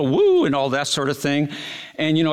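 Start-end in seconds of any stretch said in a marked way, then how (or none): 0.0-0.5 high whooping cheer; mock woo. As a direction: whoop like the crowd at fireworks. Crowd cheering at fireworks.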